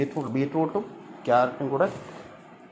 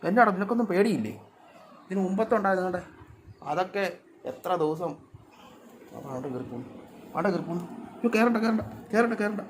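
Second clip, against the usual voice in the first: fond scolding of kittens; 5 semitones higher, 4 dB quieter than usual. Real, not acted.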